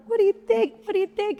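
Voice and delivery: high, loud eager question; mock eager homeowner; eager fishing. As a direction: beg for praise, hammed up. Imitating herself fishing for praise.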